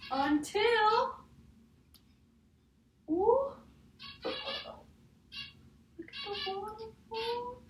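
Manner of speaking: high, wondering ooh, then a hushed waterfall; amazed storybook reveal. Acted.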